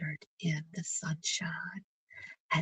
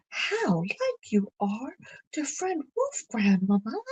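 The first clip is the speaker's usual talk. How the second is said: put-on higher, wondering child's exclamation; surprised cry; 4 semitones higher, 4 dB louder than usual. Blanche in bed notices Grandmamma looks like the wolf, surprised. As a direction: gasp it out in surprise. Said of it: "she cried".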